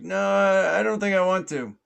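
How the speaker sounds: high, loud drawn-up acted holler; yelled refusal. Man in pond asked to come over, yelling no. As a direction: shout a refusal across the pond. Shouting refusal back to officer.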